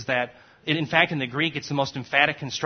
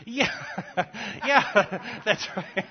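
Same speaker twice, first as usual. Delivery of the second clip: chuckling through the words, higher; laughing; 5 semitones higher, much slower than usual. Real, not acted. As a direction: laugh as you agree. Laughing at the student's joke.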